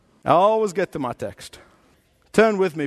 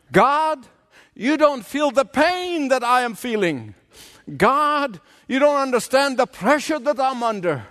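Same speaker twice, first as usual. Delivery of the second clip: higher-pitched sufferer's protest; complaining to God; 8 semitones higher than usual. Acted. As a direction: cry out your case to God in a preacher's acted plea.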